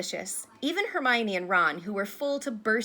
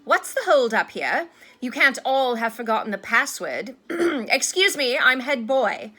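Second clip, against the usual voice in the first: loud, higher, pompous officiousness; Percy, self-important; 4 semitones higher, 9 dB louder than usual. A narrator's reading. Head boy scolding students blocking the corridor, self-important. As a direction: pull rank on the crowd. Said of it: "bustling importantly through the crowd".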